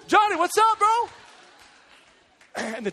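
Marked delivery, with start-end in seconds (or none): 0.1-1.1 high, loud mock greeting; hammed-up starstruck hello; excited calling out. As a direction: holler happily to a buddy in the crowd. Player greets friend in stands.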